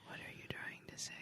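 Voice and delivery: hushed whispered aside; whispering. Real, not acted. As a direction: whisper it to the side.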